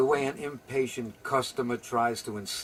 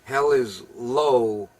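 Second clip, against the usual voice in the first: 1 slow punning; 7 dB louder, much slower than usual. Retold or imitated. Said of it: "as Sabir would say".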